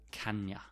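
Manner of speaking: blended "kan-yuh"; linked as one word. Shown as a demonstration. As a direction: run "kanya" together. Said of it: "pronounced like one word".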